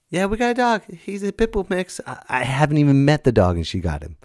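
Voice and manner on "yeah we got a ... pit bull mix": much higher imitation of the caller; caller's breezy announcement. Partner confirms the new dog to the comic, breezy. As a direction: pitch it way up, casual and matter-of-fact. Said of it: "She's like".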